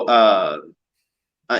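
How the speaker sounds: ordinary talk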